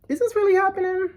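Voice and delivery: high, quick, incredulous question; high-pitched stunned reaction; bewildered disbelief. Retold or imitated. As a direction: squeak it out, dumbfounded. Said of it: "like, bewildered and dumbfounded".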